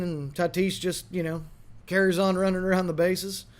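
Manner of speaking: ordinary talk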